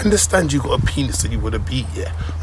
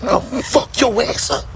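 put-on American accent; 10 semitones higher than usual. Host keeps up the American street impression, laughing, in a high American accent. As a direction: bark it like an American homie, half laughing. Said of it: "The American accent is pretty good".

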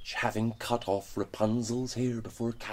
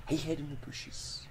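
ordinary delivery

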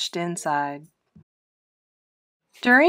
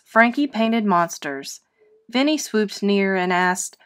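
ordinary delivery

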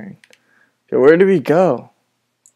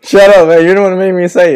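louder and higher shut up, man; loud laughing shut up; 5 semitones higher, 7 dB louder, faster than usual. Real, not acted. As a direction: yell it at your friend, half laughing. Friend made him say it.

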